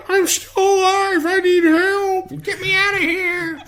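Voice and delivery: very high, slow, pleading little voice; high squeaky corpse voice. Retold or imitated. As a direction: squeak it out like a dying man faking. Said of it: "he starts talking like this".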